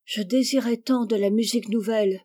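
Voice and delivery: usual speaking voice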